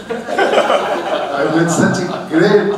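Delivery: laughing. Real, not acted. Monk telling the policeman about a great monk, laughing. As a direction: laugh out the words.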